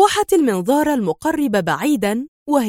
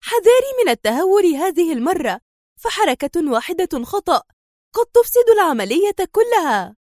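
higher-pitched startled objection; astonished; 6 semitones higher than usual. A narrator's reading. Woman warns her partner against recklessness, astonished. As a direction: react in surprise, voice pitched up. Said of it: "قائلة في دهشة".

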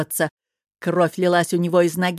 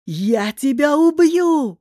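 high, drawn-out roar of fury; loud battle roar, pitch climbing; enraged roar; 9 semitones higher, 7 dB louder, slower than usual. A narrator's reading. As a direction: bellow it, stretching the last word. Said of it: "взревел Кахир".

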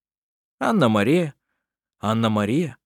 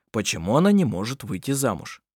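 plain reading voice

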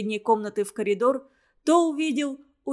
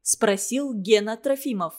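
ordinary delivery, nothing marked

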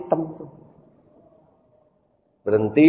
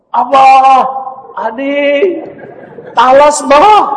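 put-on much higher, louder, excited child calling out; high, loud child shouting to his father; 11 dB louder, slower than usual. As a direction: burst in home with news. Boy excited comes home.

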